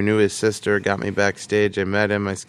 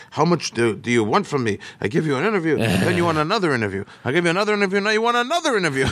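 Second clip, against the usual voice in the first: mock rant, climbing higher; going ballistic; 7 semitones higher, faster than usual. Comedian asked for another interview, mock ranting higher. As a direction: build a hammed-up tirade. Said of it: "he went ballistic".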